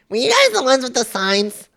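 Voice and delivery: very high squeaky lady caricature; mocking lady voice. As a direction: ask in an exaggerated falsetto, imitating her. Mimics the lady again.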